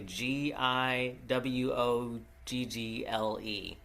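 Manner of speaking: slow, letter by letter; spelling out. Shown as a demonstration. Host unsure of the name, spelling it out slowly.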